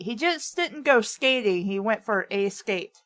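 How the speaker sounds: ordinary talk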